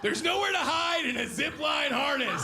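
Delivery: high, drawn-out comic wail; exasperated high-pitched yell; mock anguished shout. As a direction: cry out in put-on horror, stretching it. Mock anguish at his own body.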